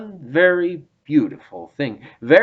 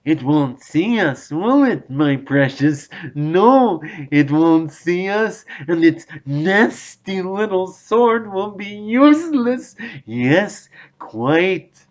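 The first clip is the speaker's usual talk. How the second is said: higher, sly creature voice, gloating; scheming Gollum; 6 semitones higher than usual. A narrator's reading. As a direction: plot it aloud with nasty glee. Gollum plotting aloud.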